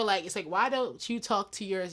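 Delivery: ordinary talk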